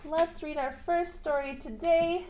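ordinary talk

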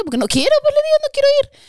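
muy aguda y fuerte, terca; negativa terca. Retold or imitated. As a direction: lo repite cada vez más alto. Se niega ante sus primos. (terca, gritando que no quiere ir)

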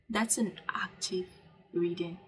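ordinary delivery

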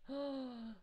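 soft, audible intake of breath; excited gasp. Real, not acted. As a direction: gasp at a happy question. Reacts to log cabin question.

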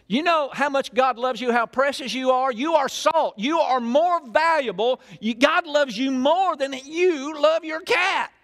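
preaching at full force. Acted. Pastor rousing the church about God's love, preaching hard. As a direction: drive it home to the congregation.

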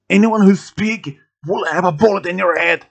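loud, high angry threat; screamed threat. A narrator's reading. Gunman threatening anyone who speaks, shouting. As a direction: shout it as a warning to the room. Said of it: "Michael screamed louder".

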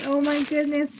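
ordinary delivery

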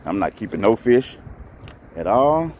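usual speaking voice

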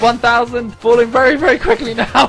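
high, loud frantic yelling; panicked shouting. Real, not acted. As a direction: panic as the ground rushes up. Crash landing panic.

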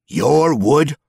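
incredulous, rising to a shrill peak; outraged disbelief. Acted. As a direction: throw the words back in disbelief. Goblin repeats, rising outrage.

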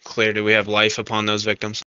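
usual speaking voice

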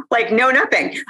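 real chuckle breaking in; laughing. Real, not acted. Laughs at her restricted diet.

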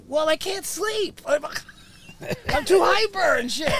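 high, loud retold protest; mock-frantic self-justifying; agitated excuse. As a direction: blurt out a worked-up excuse, laughing midway. Retells his pleading excuse to doctor.

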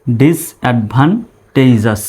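very slow ডিসঅ্যাডভান্টেজাস with gaps; ডিসঅ্যাডভান্টেজাস slowly split up for learners; broken into syllables. Shown as a demonstration. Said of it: "syllable অনুসারে ভেঙে ভেঙে উচ্চারণ করব".

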